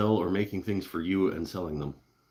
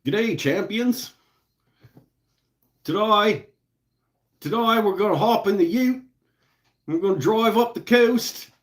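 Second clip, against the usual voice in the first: hammed-up Australian accent, much higher and louder; 14 semitones higher, 8 dB louder than usual. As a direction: play a cheesy Australian TV host. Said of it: "It's a terrible accent".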